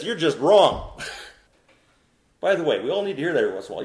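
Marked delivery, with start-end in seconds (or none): 0.0-1.0 firm, louder reproving line; blunt reproof. Shown as a demonstration. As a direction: tell them straight, no softening. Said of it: "That's where he kinda says".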